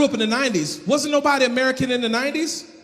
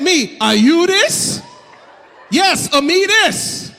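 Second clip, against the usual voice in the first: slow, loud, high mock Jamaican exchange; hammed-up island accent, drawn out and loud; Jamaican patois impression; 6 semitones higher, 5 dB louder, much slower than usual. Comic tells the crowd, mimicking the Jamaican man in a loud slow patois.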